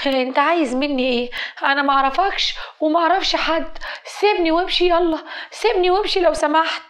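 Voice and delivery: high-pitched, rising panic in an acted girl's voice; cornered girl's agitated protest; distressed pleading. Cornered girl pleads with harassers.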